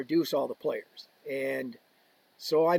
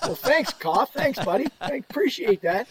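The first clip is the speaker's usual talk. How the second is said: sarcastic thanks; 7 semitones higher, 3 dB louder than usual. Retold or imitated. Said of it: "And I look at Koff".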